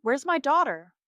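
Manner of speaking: high, loud desperate cry. A narrator's reading. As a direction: cry out for your child. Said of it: "Mom cries out".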